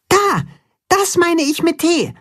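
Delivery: sehr hohe Maulwurfstimme; Maulwurf. A narrator's reading. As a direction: zeig eifrig mit hoher Stimme. Maulwurf zeigt. (Max zeigt auf den Buchstaben, sehr hoch)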